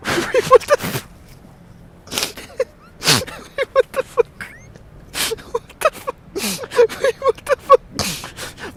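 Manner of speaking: high, squeaky, baffled laughing; baffled disbelief. Real, not acted. Streamer caught off guard on stream, high baffled disbelief. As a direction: squeak it out through laughter, incredulous.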